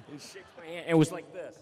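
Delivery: ordinary talk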